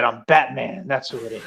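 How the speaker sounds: low, understated hero voice; Batman. Acted. Poses as Batman in retold reply.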